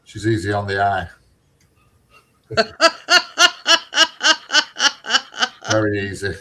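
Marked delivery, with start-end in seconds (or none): 2.5-6.3 high laugh running into the words; cheeky laugh. Real, not acted. Man laughing at his own cheeky remark about the neighbour. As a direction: chuckle it out, pleased with yourself.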